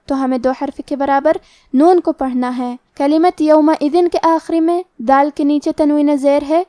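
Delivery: usual speaking voice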